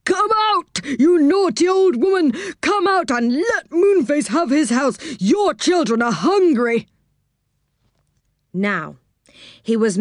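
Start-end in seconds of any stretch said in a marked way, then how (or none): 0.0-6.9 very high-pitched character shout. A narrator's reading. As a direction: call out at the top of a high voice. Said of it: "He shouted at the top of his voice".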